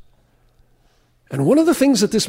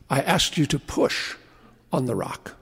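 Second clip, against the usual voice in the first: quiet voice of God; 6 semitones lower, 7 dB quieter than usual. Acted. God gently correcting the frustrated man, hushed and low. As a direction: lower and much softer, almost fading out. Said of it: "the voice came to him and said".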